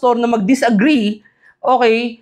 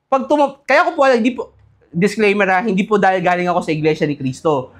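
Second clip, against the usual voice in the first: usual speaking voice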